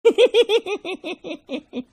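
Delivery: very high falling titter, mimicking; mocking girly giggle. Teacher mocks girls' one answer, high mocking giggle. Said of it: "girls के पास एक ही जवाब".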